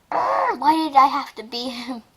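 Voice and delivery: usual speaking voice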